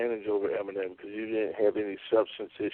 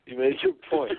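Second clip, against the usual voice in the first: "You made your point" said again much higher, laughing; amused high repeat; 11 semitones higher than usual. Real, not acted. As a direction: tease it back up high.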